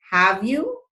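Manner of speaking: "have you" with a rise on "you"; slightly rising tag. Shown as a demonstration. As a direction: lift the end of "have you" as if requesting something. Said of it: "my voice rises slightly".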